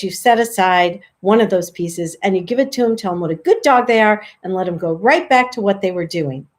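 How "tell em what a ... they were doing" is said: usual speaking voice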